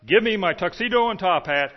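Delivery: loud stage call for the tuxedo and top hat; retold cry. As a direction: call out like an actor leaving the stage. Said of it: "he was to cry out".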